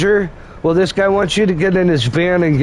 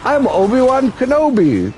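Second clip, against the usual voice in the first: mock Obi-Wan; 7 semitones higher than usual. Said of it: "Would've been like".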